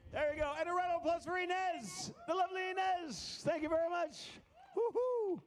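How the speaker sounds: loud, high showman cheer over music; excited hype. Real, not acted. Magician calling the audience to applaud Mark, loud high showman hype. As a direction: whip the crowd into applause.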